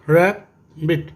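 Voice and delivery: Rabbit slowly as two beats, रै and बिट; rabbit broken after the first syllable. Shown as a demonstration. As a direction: leave a gap inside rabbit. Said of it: "ये भी टू syllable है".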